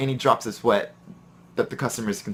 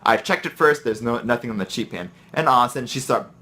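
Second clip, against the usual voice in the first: normal talking voice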